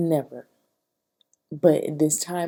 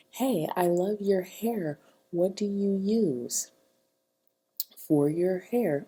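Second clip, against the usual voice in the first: sweet compliment; 3 semitones higher, slower than usual. Pretend. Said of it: "go over there to her and be like".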